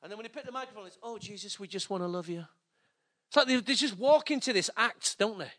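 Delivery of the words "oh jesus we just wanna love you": soft, mock-pious worship leader; mock spiritual. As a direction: send up a suddenly spiritual musician, softly. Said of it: "all of a sudden, they get spiritual".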